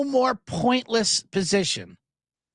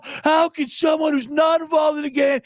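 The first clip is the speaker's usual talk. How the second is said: whiny critic mock; 7 semitones higher than usual. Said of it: "Everyone's like".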